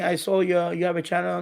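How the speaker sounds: ordinary talk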